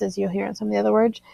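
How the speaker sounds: ordinary talk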